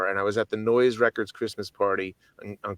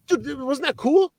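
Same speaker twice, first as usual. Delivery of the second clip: excited; slower than usual. Retold or imitated. Retold excited question to friend.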